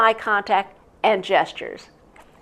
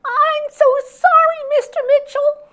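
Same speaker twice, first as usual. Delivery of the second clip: scared bully voice; 7 dB louder than usual. Retold or imitated. Teacher imitating bully apologizing, high and meek. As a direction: squeak out the apology like a cowed bully. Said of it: "mimic the voice of someone".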